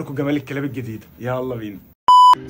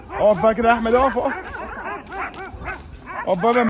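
calling out to stop; 8 semitones higher than usual. Real, not acted. Telling Ahmed to stop, high raised calls. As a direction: call out to halt him.

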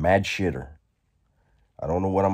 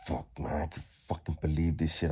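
muttered quote; 2 semitones lower, 8 dB quieter, faster than usual. Retold or imitated. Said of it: "he's just like".

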